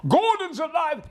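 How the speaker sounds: huge, high-pitched bellow; thunderous theatrical shout; booming catchphrase. Acted. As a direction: let it rip at full volume. Obliges Queen with booming catchphrase.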